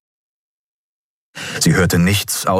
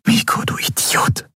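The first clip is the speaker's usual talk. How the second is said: whisper. A narrator's reading. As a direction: whisper it over his body. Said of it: "flüsterte sie".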